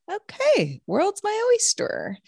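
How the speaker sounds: high-pitched, blissfully carefree self-quote; mock carefree. Recalling a happy morning before the shock, mock carefree.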